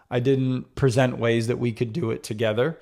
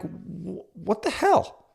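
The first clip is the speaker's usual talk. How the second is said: stunned disbelief; 6 semitones higher, 5 dB quieter, much slower than usual. Retold or imitated. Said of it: "And then I was like".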